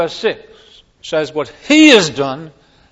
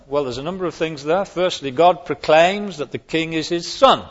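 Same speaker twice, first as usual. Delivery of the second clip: normal talking voice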